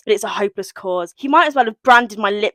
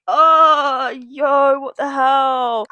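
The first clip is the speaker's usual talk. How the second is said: drawn-out, raised cry of dismay; exasperated wail; 5 semitones higher, much slower than usual. Acted. Rowan acted out after the kiss, exasperated wail. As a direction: stretch it out in mock despair. Acts Rowan's panicked regret.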